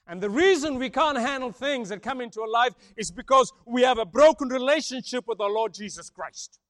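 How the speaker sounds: ordinary delivery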